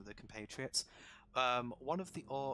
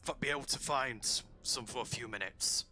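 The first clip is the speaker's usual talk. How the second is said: orc voice; 3 semitones higher than usual. Acted. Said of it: "the other orc goes".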